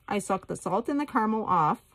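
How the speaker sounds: ordinary delivery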